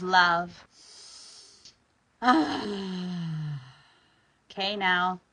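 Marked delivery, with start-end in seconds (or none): 2.2-3.6 long audible sigh out; exhaling sigh. Shown as a demonstration. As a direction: breathe out with a sigh. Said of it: "Exhale with a sigh".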